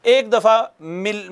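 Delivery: ordinary delivery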